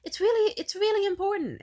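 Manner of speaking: very high, loud, repeated plea; insistent pleading child. Retold or imitated. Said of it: "I really tried".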